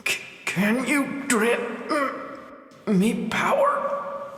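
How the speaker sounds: high, slow, breathy ask; hoping for power; weak whispered question; hesitant plea. Acted. Hero asking.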